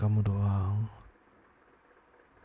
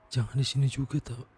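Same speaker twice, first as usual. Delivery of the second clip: ordinary delivery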